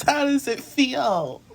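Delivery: high, slow teasing repeat; mocking high voice. Mocking repeat, then laughs.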